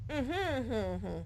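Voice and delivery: talking through a mask; muffled mumbling; muffled under a mask. Pretend. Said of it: "what did you say? I couldn't quite make it out".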